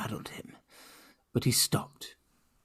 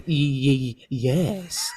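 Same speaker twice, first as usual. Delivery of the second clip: stammered; 4 dB louder, much slower than usual. A narrator's reading. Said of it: "he stammered".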